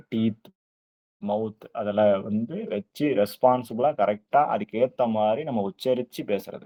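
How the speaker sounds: ordinary talk, nothing marked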